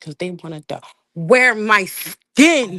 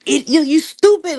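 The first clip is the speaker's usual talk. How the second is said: higher and louder, hitting "stupid" hard; raised-voice put-down, "stupid" stressed; loud insult; 9 semitones higher, 6 dB louder than usual. Real, not acted. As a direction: spit out "you stupid" with contempt. Insulting the caller.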